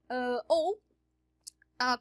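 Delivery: ordinary delivery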